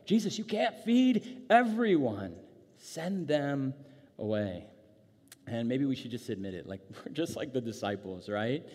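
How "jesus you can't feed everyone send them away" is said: high, slow exasperation falling to resignation; drawn-out fed-up sarcasm, sinking at the end; sarcastic. Acted. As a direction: protest loudly, then wearily give up. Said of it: "we should definitely hear this sarcastically".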